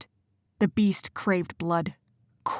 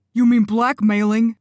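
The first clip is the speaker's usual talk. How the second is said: louder, higher, biting; hissed accusation; 4 semitones higher, 7 dB louder than usual. Acted. Benny accuses Quentin, furious and biting. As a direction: spit the accusation back. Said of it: "Benny hissed".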